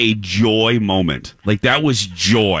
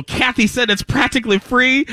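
excited; 13 semitones higher than usual. Retold or imitated. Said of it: "I'm all excited".

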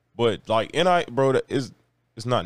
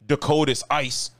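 ordinary talk

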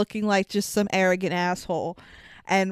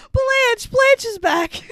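high, loud, gleeful squeal of recognition; excited squeal; 14 semitones higher, 4 dB louder, slower than usual. Retold or imitated. On a movie podcast, a fan squeals with excitement that the chicken is back. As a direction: shriek the name like spotting an old friend.

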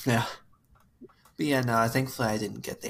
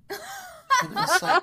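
squealing laugh way up high; slow, squeaky laughing and it was like; slower than usual. Real, not acted. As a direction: break into a high disbelieving laugh.

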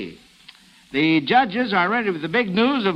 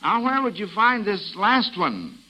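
ordinary delivery

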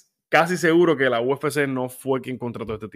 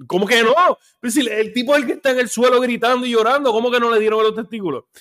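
loud, high-pitched incredulous outburst; indignant; 9 semitones higher, 5 dB louder, faster than usual. Real, not acted. Host disputing the doctor's call, indignant. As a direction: protest in disbelief, voice jumping up.

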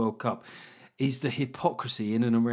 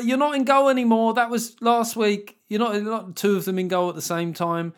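louder, higher coach calling out instructions; exasperated coach; 8 semitones higher, 5 dB louder than usual. Acted. Coach telling six-year-old players what to do, exasperated. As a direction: call it out to muddled kids. Voices coach correcting small kids.

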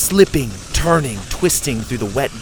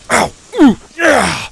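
short, high, loud cries; yelps of pain; 11 semitones higher, 9 dB louder, slower than usual. A narrator's reading. Boys tumbling down a tunnel, loud interrupted yelps. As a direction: yelp as if jolted. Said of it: "screamed their brains out".

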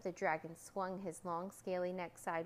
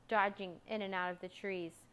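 ordinary delivery, nothing marked

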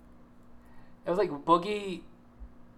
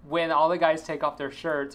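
usual speaking voice

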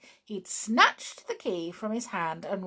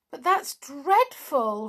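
dismay; 7 semitones higher, 5 dB louder, slower than usual. Acted. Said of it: "said Jolly in dismay".

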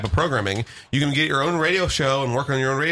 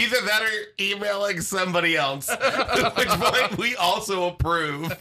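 higher-pitched, talking through laughter; laughing; 7 semitones higher than usual. Real, not acted. Host joking to co-host about misdirected emails, laughing. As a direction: crack up while getting the words out.

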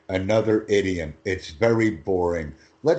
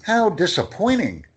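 exaggerated disappointment; 9 semitones higher, 5 dB louder than usual. Shown as a demonstration. Tutor acting disappointment.

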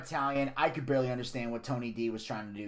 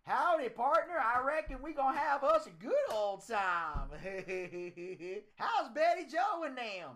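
high-pitched, hammed-up hillbilly accent; put-on Southern accent; 10 semitones higher than usual. The Don showing off a voice to rivals, cartoon hillbilly accent. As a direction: do a giggling Southern yokel accent. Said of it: "I'm the man of many fucking voices".

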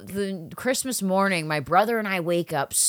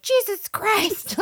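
shocked exasperation; 14 semitones higher, much slower than usual. Retold or imitated. Seeing dad's underwear.

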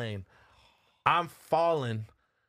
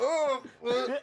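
high pained "oohs"; 11 semitones higher than usual. Retold or imitated. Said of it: "I almost threw up my nut".

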